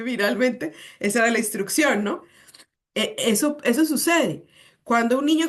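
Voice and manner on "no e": ordinary talk, nothing marked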